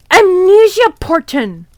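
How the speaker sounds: slow, high, loud reveal; dramatic reveal. Real, not acted. Reader revealing the twist, slow, loud and high. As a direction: land it like a twist.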